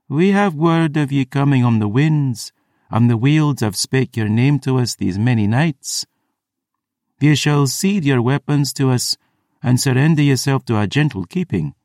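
high, lilting priestess voice; melodious, stately command; musical priestess. A narrator's reading. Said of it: "spoke with a deep musical voice".

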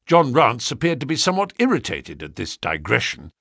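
plain reading voice

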